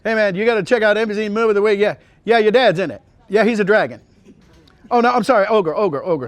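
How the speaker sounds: high, loud, rapid-fire excitement; excited phone call. Retold or imitated. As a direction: gush to your cousin about the TV movie. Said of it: "I always call up my cousin".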